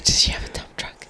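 whispered cheeky question; whispering. Real, not acted. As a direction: whisper it like a secret. Cheeky aside.

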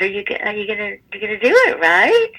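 retold hopeful question; eager checking. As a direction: push for a yes, climbing to a high "right?". Acted anxious check with boyfriend.

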